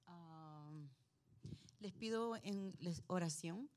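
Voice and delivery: ordinary talk, nothing marked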